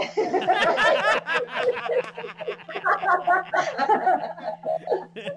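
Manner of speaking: rising peals of laughter; big rising laugh. Real, not acted. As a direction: burst out laughing at your own joke. Laughs at her own pun.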